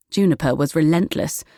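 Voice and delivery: ordinary delivery, nothing marked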